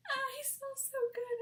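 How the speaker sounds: high, loud hammed-up crying; exaggerated tearful squeal, imitating her; mock bawling. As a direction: wail it like an overwhelmed fangirl.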